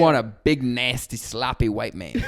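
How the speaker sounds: loud, laughing imitation of a scolding coworker; retelling an office roast, mock scolding voice; mock sassy coworker. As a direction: ham up the coworker roasting him. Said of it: "she's like".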